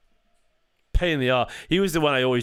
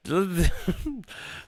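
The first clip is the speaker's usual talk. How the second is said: incredulous laugh; 7 semitones higher than usual. Real, not acted. Scoffs at viewer's chat claim.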